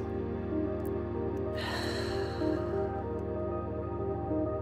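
long, soft, breath-only sigh; long exhale. Shown as a demonstration. Guide showing listeners how to release tension, long sighing exhale. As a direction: let all the tension out in one slow outbreath.

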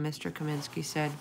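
usual speaking voice

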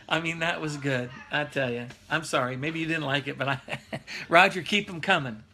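usual speaking voice

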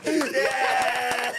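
high, loud whoop of approval; excited cheer. Real, not acted. Host cheering the comic's comeback, loud excited whoop. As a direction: cheer him on like a fan.

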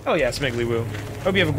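ordinary talk, nothing marked

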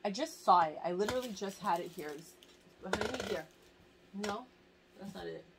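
usual speaking voice

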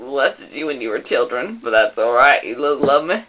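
lightly hammed-up deep dad. Plays deep-voiced dad.

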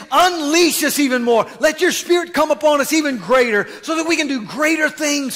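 loud, high, fervent preaching plea; bold prayer. Acted. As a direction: pray it out boldly at full force. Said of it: "They weren't whining and crying".

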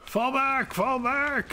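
shouting orders over battle. Real, not acted.

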